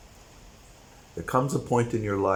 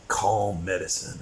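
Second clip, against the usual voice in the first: ordinary talk, nothing marked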